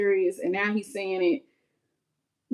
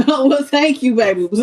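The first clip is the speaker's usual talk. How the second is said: laughing thanks, a bit louder; real laughter; 4 semitones higher, 7 dB louder than usual. Real, not acted. Laughs at viewer's correction.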